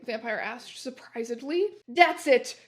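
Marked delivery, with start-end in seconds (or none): 1.9-2.6 loud angry outburst; angry shout. Acted. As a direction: snap it out in anger. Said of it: "shouted angrily".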